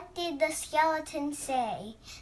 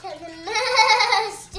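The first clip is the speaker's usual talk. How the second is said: shouted punchline; 7 semitones higher, 8 dB louder, slower than usual. Real, not acted. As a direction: belt it out proudly. Little girl shouts punchline.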